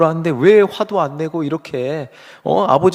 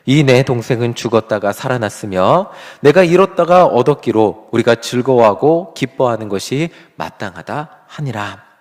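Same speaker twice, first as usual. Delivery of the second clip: slow and loud, reading aloud together; congregational scripture reading; 4 semitones lower, 6 dB louder than usual. Shown as a demonstration. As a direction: read the verse in slow unison with the church. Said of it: "우리 함께 읽어보겠습니다".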